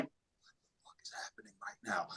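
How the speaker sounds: whispered inner disbelief; hushed, voiceless aside of amazement; stunned whisper. Retold or imitated. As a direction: whisper it to yourself, dumbfounded. Inner thought, stunned, retold.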